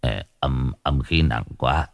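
sweating priest muttering in fear; fearful murmur. A narrator's reading. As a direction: mutter in dread. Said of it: "toát mồ hôi hột".

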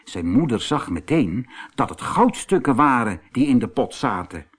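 plain reading voice

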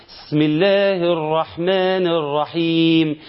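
slow chant with the ب of بسم left out, "sm"; slowly chanted basmala, swallowing the ب; dropped letter demo. Shown as a demonstration. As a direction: recite بسم with no ب sound. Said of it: "إن أنا ما قرأتش الباء".

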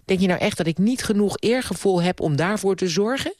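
high-pitched indignant questioning; angry. A narrator's reading. Niece defending her sense of honour, angry. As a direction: demand it in rising indignation. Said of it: "zei ze boos".

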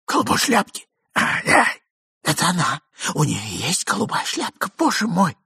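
high anxious exclamation; muffled panic; alarmed cry. A narrator's reading. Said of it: "закричал Иван Андреевич".

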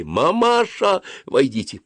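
high, drawn-out call; shouted summons. A narrator's reading. Dentist summoning the mother from outside, calling out. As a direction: stretch the call toward the door. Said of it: "закричала, повернувшись к двери".

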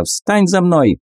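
high squeaky monkey command; squeaky macaque. Acted. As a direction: order it in a shrill monkey pipe. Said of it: "писклявым голосом макака".

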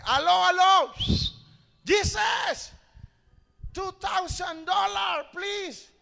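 high, loud mock-desperate shouting; gritando a Dios. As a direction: shout up to heaven as if down a dead phone line, drawing out the words. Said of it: "grité". (gritos agudos de súplica exagerada)

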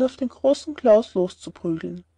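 plain reading voice

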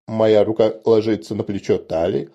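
plain reading voice

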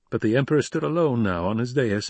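plain reading voice

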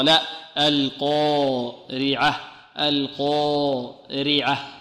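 "القارعة" stretched very slowly with a full heavy qaf and alif; نطق صحيح مفخم; correct recitation. Shown as a demonstration. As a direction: model "القارعة" slowly and clearly. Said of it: "القارعة صحيح هذا أو خطأ".

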